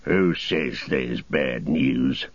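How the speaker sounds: low, slow orc challenge; soldier orc, mild shout. A narrator's reading. Soldier orc challenging the tracker, low slow snap. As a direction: demand it gruffly. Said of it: "shouted the soldier".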